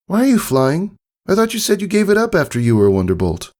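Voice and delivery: high, quick, curious filly. A narrator's reading. Daughter asking her father why he flies again, quick and high. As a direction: rush the question out, puzzled.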